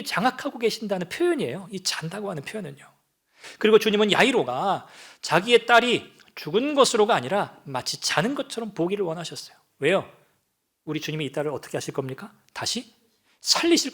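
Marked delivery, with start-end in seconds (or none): none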